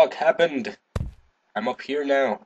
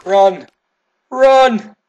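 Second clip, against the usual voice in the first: loud high command, second one higher; urgent yell; 10 semitones higher, 11 dB louder than usual. Real, not acted. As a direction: shout to make them flee. Urging game character to flee.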